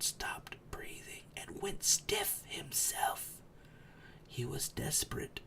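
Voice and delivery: breathless, soft unvoiced telling; tense whisper. A narrator's reading. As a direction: hold your breath with Bilbo.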